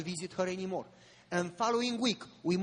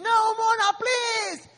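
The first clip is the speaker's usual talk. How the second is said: desperate high scream, much higher and louder; mother's scream; 14 semitones higher, 10 dB louder, slower than usual. Retold or imitated. Mother at the window begs her daughter not to jump, screaming. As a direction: beg her not to jump at full volume. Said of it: "Mona's mother screaming".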